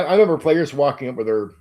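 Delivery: ordinary talk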